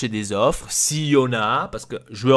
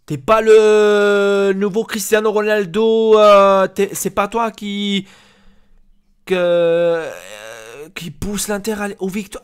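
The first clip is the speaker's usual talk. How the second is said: high, drawn-out sarcastic build-up; mocking taunt; 8 semitones higher, 7 dB louder, slower than usual. Boss taunting a player without offers, drawn-out mockery. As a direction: stretch the words out high, then ease off.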